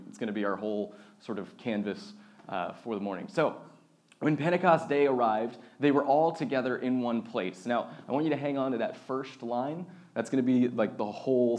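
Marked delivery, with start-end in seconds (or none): 4.2-7.7 raised, projected Bible-reading voice; scripture reading. Acted. Pastor opens the Pentecost passage for the congregation, reading aloud. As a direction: read the passage out to the room.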